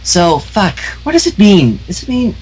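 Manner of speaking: high, bouncy cartoon pony voice; high, giddy pony chatter; bubbly Pinkie Pie. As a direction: chirp it like an overjoyed pink pony.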